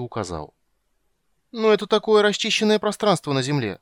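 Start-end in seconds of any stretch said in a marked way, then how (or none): 1.5-3.8 high, loud whiny plea; plaintive. Acted. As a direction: say it plaintively, pleading. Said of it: "сказал Тасс жалобно". (жалобно)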